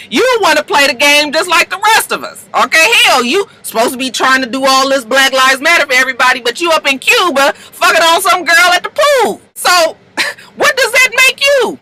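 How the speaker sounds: high, building mock tirade; acted angry accusation. Fitz calls out Marcus's hypocrisy, high and heated. Fitz fires back in quarrel.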